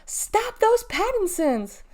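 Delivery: high, slow drawn-out howl. A narrator's reading. Cashier chases the kids down the walk, howling. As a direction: howl after fleeing culprits. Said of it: "with Norma howling".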